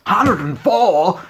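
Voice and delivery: high, loud, slow disbelief; shocked repeat. Retold or imitated. Stepdad aghast at the ticket price, high and slow. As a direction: balk at the price.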